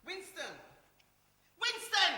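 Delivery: loud call to wake someone; calling out loudly. Acted. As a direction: yell the name across the room.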